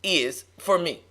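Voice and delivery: loud, slow, weighty preacher delivery; measured, forceful sermon cadence; preaching. Real, not acted. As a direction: preach it slowly, leaning on each word. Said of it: "You preaching that thing".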